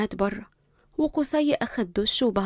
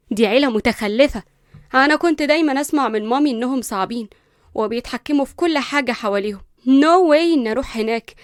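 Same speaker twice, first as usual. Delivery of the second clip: loud, higher shocked outburst; outraged; 4 semitones higher, 8 dB louder than usual. Acted. Linda outraged at the will's condition, shouting at Uncle Hamdi. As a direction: blow up at him in disbelief.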